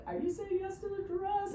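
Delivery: high, bright show-host question; big question. Retold or imitated. Stylist asking the bride the big question, high and showy. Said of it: "so we do the whole, you know, ask her".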